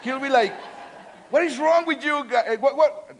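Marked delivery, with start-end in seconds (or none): 1.3-3.2 high, loud mock-upset child; angry toddler. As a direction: play a cross little boy. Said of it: "he will be all angry with me".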